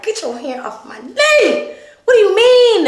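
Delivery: exasperated. Retold or imitated. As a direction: push him off, fed up and baffled. Acted reply in her head.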